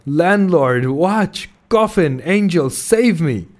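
frantic plea to be saved; terrified shout. A narrator's reading. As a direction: cry out in panic for anyone. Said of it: "shouted I".

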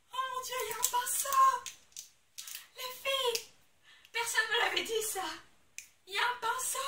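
high, loud squeal of delight; squealing excitement. Real, not acted. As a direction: squeal like you just found a treasure. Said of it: "je vais me calmer". (cri aigu de joie, fort et haut perché)

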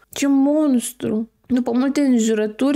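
usual speaking voice

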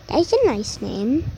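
ordinary talk

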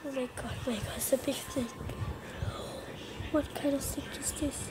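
tense hushed whisper. Real, not acted. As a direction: whisper it, scared of the snake. Tense moment spotting a snake.

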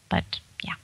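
whispered trailing-off; soft unvoiced wrap-up. Real, not acted. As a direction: let it fade to a breath. Trailing off after answer.

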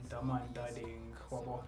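normal talking voice

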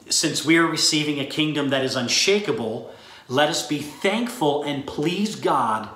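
ordinary talk, nothing marked

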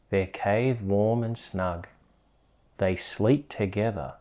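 ordinary delivery, nothing marked

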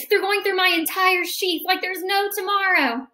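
plain reading voice

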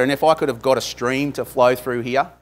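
ordinary delivery

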